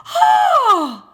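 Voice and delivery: very high, loud scream of fright; frightened shriek. A narrator's reading. Three ladies see the lion and scream in fright. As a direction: shriek as if chased by an ogre. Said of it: "cried the three ladies".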